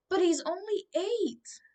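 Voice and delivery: high, loud pleading yell; mother yelling at the soldier. A narrator's reading. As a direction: cry out in protest, pitched high. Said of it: "his mother yelled".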